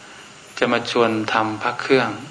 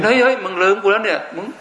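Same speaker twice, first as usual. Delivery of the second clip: calling out to owner; 8 semitones higher than usual. Pretend. Amulet calling its forgetful owner.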